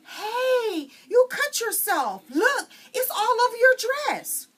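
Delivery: put-on high, loud panicked girl's cry; alarmed shout. Narrator girl shouts to Pecola about the blood. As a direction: yell it out in a fright.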